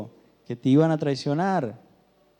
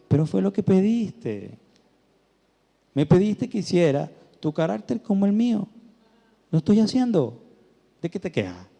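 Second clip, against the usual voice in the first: reproche suave y algo más agudo; voz de Dios recordando; 7 semitones higher, 3 dB louder than usual. Retold or imitated. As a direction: como Dios que le recuerda lo que pidió. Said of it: "él sutilmente me ponía mi mente en memoria". (Dios le recuerda su petición, con reproche suave)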